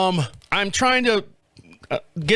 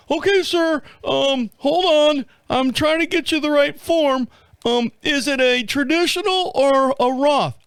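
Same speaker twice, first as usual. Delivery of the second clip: high, hesitant trainee voice; hammed-up first-day phone clerk; rookie dispatcher type; 7 semitones higher, slower than usual. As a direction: fumble through it in a high, silly voice. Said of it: "like a nine one one dispatcher on his first day".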